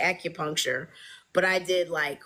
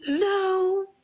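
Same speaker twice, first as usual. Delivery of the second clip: innocent fib; 13 semitones higher, 4 dB louder than usual. Retold or imitated. Fibbing to the nurse.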